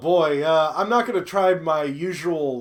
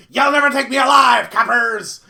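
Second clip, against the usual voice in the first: loud mock gangster yell; hammed-up defiant shout; 3 semitones higher, 10 dB louder than usual. Player jokingly defies arriving police with a hammed-up shout. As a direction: bellow it like a cornered crook.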